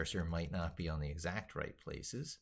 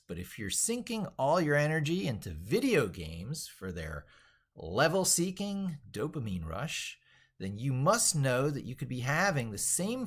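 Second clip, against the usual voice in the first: bright, emphatic book reading, higher and louder; animated reading aloud; 8 semitones higher, 5 dB louder than usual. Acted. Streamer reading his own book to viewers, lively read-aloud. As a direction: sell the book's pitch like an enthusiastic author.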